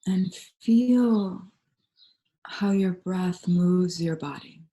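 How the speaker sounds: calm, unhurried meditation voice with long pauses; guided meditation. Real, not acted. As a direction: guide them gently into stillness, leaving space between phrases.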